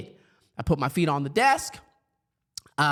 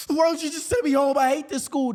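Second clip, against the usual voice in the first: high-pitched mock tantrum yell; acted yell; 9 semitones higher, 3 dB louder than usual. Eight-year-old self to vice principal, high acted yelling. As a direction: yell it high like a fed-up kid. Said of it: "And I yell at her".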